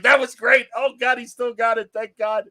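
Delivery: high, loud relieved cheer; excited shout. Retold or imitated. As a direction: burst out in joyful relief. Relieved cheer after the song.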